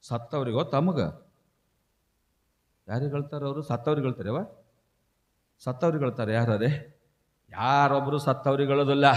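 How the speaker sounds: ordinary talk, nothing marked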